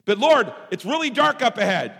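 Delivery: loud, high objection; acted worried complaint, high and loud; protesting to God. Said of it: "when I go to God".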